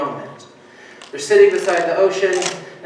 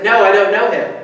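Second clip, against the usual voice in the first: loud, higher, emphatic denial; insistent, raised-voice denial; firmer denial; 4 semitones higher, 7 dB louder than usual. Acted. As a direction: push back hard and loud. Peter's second, firmer denial.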